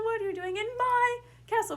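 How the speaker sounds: "my" shouted high and held; stress on my. Shown as a demonstration. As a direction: make "my" the outraged peak, high and loud. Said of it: "pick one word in that sentence to really emphasize".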